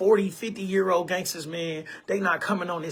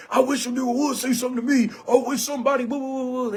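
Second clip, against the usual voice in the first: fast, higher, hammed-up internet thug taunt; mock tough-guy threat; 6 semitones higher, faster than usual. Mocking online tough guys who make threats, in a hammed-up thug voice. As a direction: sneer it out like a keyboard gangster daring anyone.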